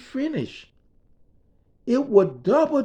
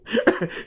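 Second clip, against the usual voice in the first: silent laugh. Real, not acted. Crew laughing at boss's joke.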